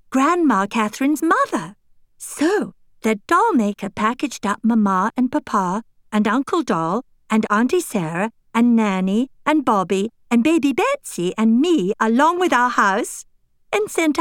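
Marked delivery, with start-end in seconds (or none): none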